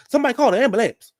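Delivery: high, fast, loud call for help; Sheree's panic call. Retold or imitated. Quoting Sheree at the scene, a high fast panicky call. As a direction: yell it like someone in a panic. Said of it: "like Sheree said".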